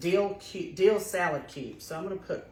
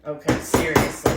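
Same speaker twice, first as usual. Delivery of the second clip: loud exasperated strain; straining at a stuck lid; opening a stuck jar; 11 dB louder than usual. Real, not acted.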